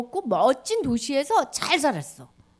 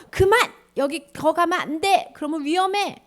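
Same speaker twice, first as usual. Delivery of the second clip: loud, high stop-there cry; urgent warning shout; 5 semitones higher, 5 dB louder than usual. Acted. God warning people through Malachi, urgent shout. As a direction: yell to stop someone from danger. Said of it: "뭐라고 얘기하시냐면".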